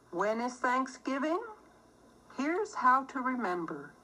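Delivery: plain reading voice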